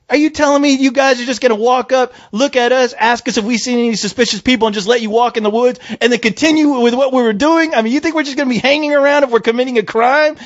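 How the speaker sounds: high-pitched, incredulous retold protest; indignant arguing. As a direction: argue back in one rising rant. Retold indignant argument with cops.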